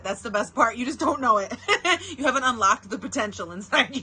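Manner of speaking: ordinary delivery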